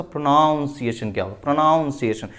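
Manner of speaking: प्रोनाउंसिएशन with "nown" as its second syllable; common mispronunciation. Shown as a demonstration. Teacher quoting how many people would ask it, mispronunciation. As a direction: voice the mistake pro-nown-see-ay-shun in प्रोनाउंसिएशन. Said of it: "बहुत लोग इसको बोलेंगे".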